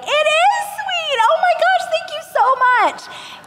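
high-pitched, overjoyed mock gratitude; gushing thanks. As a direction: thank them like a naive fan. Naive gushing thanks, mocked.

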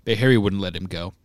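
plain reading voice

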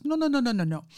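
put-on light Indian accent, rapid run of noes starting high. Caller refusing her offer to find someone, Indian accent. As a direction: cut in with an imitated Indian accent. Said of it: "he has a very strong Indian accent".

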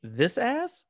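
questioning line read; acted line. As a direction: deliver it as a question. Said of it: "Let me put my acting face on real quick".

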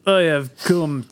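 slow Russian villain attempt; deliberate mock-Russian delivery; Russian accent. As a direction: start a measured, put-on Russian accent. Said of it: "do a Russian accent".

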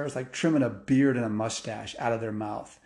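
usual speaking voice

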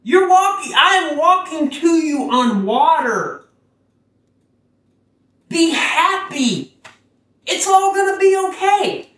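high, soaring and bright-eyed, urging joy; Jesus voice. Retold or imitated. Jesus calling to Peter from the water, high and joyful. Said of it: "He said".

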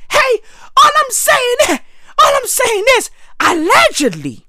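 high-pitched, insistent pleading; defensive protest. Acted. Defending himself over the rumour, high defensive protest.

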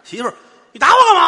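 又高又响的尖叫质问; 挨了一巴掌，冲媳妇尖声喊; 尖声叫嚷. Acted. 被打后冲媳妇喊叫. (挨打的丈夫冲媳妇尖声嚷嚷)

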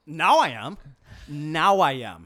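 loud, exasperated comeback, second time drawn out; hammed-up irritation, punched loudly; mad retort. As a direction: fire back as if suddenly provoked. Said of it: "I'm getting mad just thinking about it".

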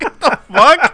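high, loud, breaking into laughter; cracking up in retold shock; laughing disbelief. Retelling the salesman's VCR offer, laughing in disbelief.

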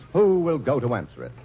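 ordinary talk

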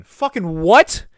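loud, high, stretched-out disbelief; incredulous shout. Retold or imitated. Reacting to a stolen handgun in the retelling, incredulous shout. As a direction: blurt it out, aghast and drawn out.

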